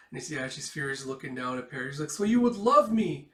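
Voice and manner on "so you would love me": pleading cry. Retold or imitated. Girl pleading for love.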